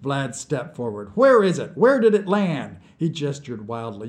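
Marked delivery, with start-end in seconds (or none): 1.1-2.8 loud, high, insistent questions; agitated, high-pitched interrogation; urgent demand. A narrator's reading. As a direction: fire questions at him, voice raised. Vlad demands answers, gesturing wildly.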